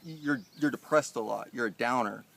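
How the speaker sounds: mimicking Jeff's scolding, loud and mocking. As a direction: parody his lecture. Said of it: "He's like".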